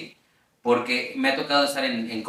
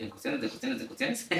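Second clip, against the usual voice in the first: ordinary delivery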